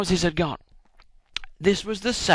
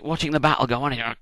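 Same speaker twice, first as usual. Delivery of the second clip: ordinary delivery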